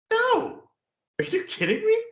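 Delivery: high, loud disbelieving cry; incredulous outburst. Real, not acted. Employee reacting to a boss's text, incredulous shriek.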